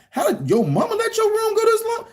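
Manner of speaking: high, outraged dad voice; exasperated dad. Retold or imitated. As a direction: throw up your hands at the kid.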